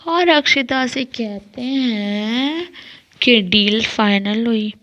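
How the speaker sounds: drawn-out, slow narration; slow, heavy storytelling; very slow telling. Real, not acted. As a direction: stretch each word out slowly. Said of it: "मतलब त्रिश".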